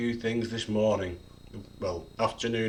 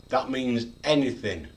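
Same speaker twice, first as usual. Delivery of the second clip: normal talking voice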